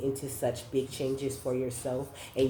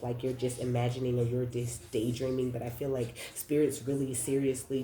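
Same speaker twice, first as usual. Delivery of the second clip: usual speaking voice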